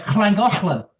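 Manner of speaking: "Llangollen" with hissed Welsh double Ls; Welsh pronunciation. Shown as a demonstration. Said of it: "the double L, which is pronounced".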